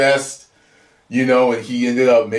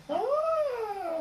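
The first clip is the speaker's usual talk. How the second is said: emotional gasp; 11 dB quieter than usual. Real, not acted. Moved at the finale.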